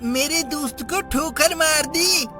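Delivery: high, agitated telling-off; indignant scolding. Acted. Boy tells off the man who kicked his friend, indignant.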